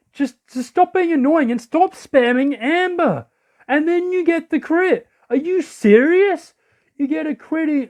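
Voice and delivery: high-pitched indignant rant; real exasperation. Real, not acted. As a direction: whine in outraged disbelief. Opponent keeps spamming, lands critical.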